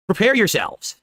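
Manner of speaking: ordinary delivery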